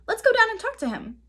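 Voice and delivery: high, fast excited urging; eager. A narrator's reading. Girl eagerly urging her brother to go down. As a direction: rush it with delight.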